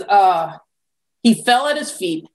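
ordinary talk

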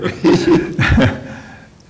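breathy amused chuckle. Real, not acted. Mayor laughs after the joke.

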